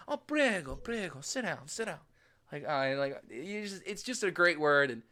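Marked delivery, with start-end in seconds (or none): 0.1-2.0 a light take on an eager owner calling prego; Italian restaurant owner. Retold or imitated. Owner calling tourists to sit, a lightly done welcome. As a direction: coax passers-by to sit, lightly voiced. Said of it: "the owner is out there".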